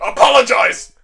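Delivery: loud, high mock command; hammed-up imperious shout; demanding. Demon Lord confronts insulting wolves, demanding.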